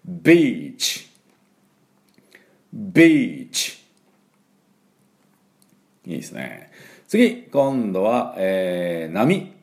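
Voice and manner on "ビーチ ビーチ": slow ビーチ with long ee; model beach. Shown as a demonstration. Teacher leading students in repeating ビーチ. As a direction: drag out ビーチ clearly. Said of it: "私の後についていきましょう".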